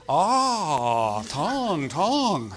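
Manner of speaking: drawn-out, swooping mock Korean accent; hammed-up Korean-accented exclamation; imitated Korean accent. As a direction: stretch each word in a caricatured Korean accent. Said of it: "South Korean man".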